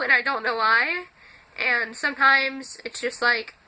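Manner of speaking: ordinary talk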